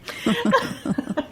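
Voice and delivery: light, high-pitched giggle; high laugh. Real, not acted. Laughing at clam joke.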